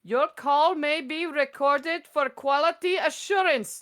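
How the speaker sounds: high, sugary call-center politeness; scam caller voice. Retold or imitated. Retelling the scam caller's sweet reply in a high voice. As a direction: lightly mimic an overly polite scammer. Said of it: "she's like".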